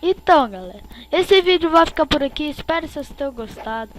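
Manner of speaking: loud, upbeat sign-off; youtuber outro. Acted. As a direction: wrap up the video with energy. Video outro.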